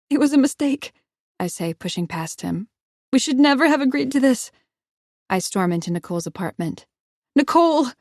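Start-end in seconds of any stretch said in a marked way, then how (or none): 0.1-0.9 high, loud, near tears; tearful outburst. Acted. Heroine tearfully pushing past Evan. 3.1-4.5 high, loud, near tears; tearful outburst. Acted. Heroine tearfully pushing past Evan. 7.4-7.9 high, loud calling shout. Acted. Heroine shouting for Nicole, loud. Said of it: "I shout".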